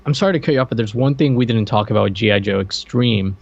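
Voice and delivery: ordinary talk, nothing marked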